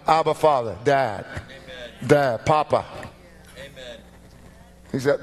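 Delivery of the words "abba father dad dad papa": preaching cry. Acted.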